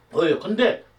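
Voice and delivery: ordinary talk, nothing marked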